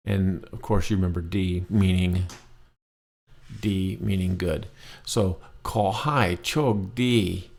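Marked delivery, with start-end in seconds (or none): 5.6-7.5 Ko hai chok di said very slowly; slow demo. Shown as a demonstration. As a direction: stretch Ko hai chok di out for learners.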